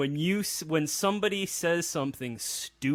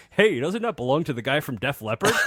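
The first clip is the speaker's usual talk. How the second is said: laughing; 3 semitones higher than usual. Retold or imitated. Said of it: "Crow or Tom Servo was like".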